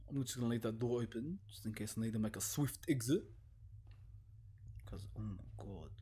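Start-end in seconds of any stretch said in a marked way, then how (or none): 4.9-5.9 lower, much softer awed breath; hushed dread. Real, not acted. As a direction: murmur it in dread. Sees the long dark corridor.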